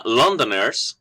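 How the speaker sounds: usual speaking voice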